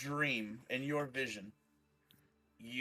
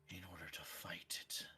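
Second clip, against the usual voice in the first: breathy quick whisper; 5 semitones lower, 12 dB quieter, faster than usual. Acted. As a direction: whisper the final warning. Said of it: "just chuckles and goes".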